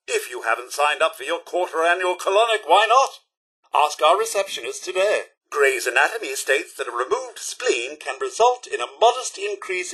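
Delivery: measured promotional announcement read; smooth, matter-of-fact hospital announcer; public-service announcer. Acted. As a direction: deliver health advice like a chipper advert. Recorded hospital announcements, advert patter.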